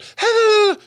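short very high falsetto squeal; squeaky mock babble; mimicked fake voice. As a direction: imitate the silly put-on tone without words. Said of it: "You went from".

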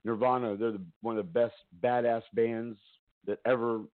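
ordinary talk, nothing marked

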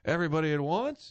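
put-on a touch of a fussy old announcer; Harry Caray. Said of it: "He said".

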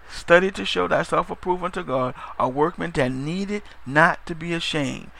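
ordinary talk

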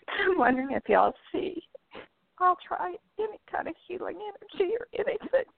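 high, tearful voice; emotional, crying. Real, not acted. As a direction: plead for help through real tears. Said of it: "Sorry, I'm gonna get emotional".

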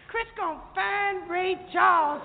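high, slow excited gush; a hint of a thrilled queen; Isabel excited. Isabel telling everyone about Chris, high excited gush. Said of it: "Isabel saying".

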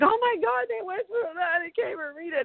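high, overwhelmed gush over a sad chapter; teary overwhelmed reader. Retold or imitated. Former self reacting to a sad chapter, high overwhelmed gush. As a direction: gasp it out, swept up in their pain. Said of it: "I used to be like".

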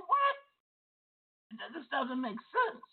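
sudden high What in disbelief; startled outcry of disbelief; shocked disbelief. Retold or imitated. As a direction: react as if you can't believe it. Shocked reply to the spirit.

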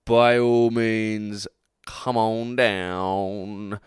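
very slow, drawn out invitation; slow game show host. Acted.

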